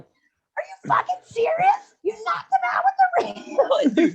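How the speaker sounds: very high, slow outraged aunt voice; aunt's shriek. Retold or imitated. Aunt yelling at the fireman, shrill disbelief. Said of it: "I wake up to his aunt like".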